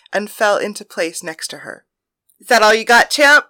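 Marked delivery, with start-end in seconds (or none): 2.4-3.5 teasing. Acted. Playful bedroom teasing challenge.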